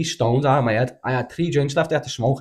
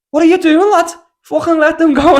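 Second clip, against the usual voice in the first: much higher, much louder retold yell; shouting from the window; 10 dB louder than usual.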